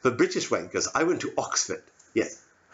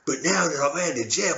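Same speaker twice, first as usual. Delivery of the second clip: ordinary talk, nothing marked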